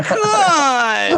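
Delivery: high, whiny mock begging; desperate pleading. Wingman begging his reluctant friend, desperate whine. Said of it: "look desperate in front of your friend".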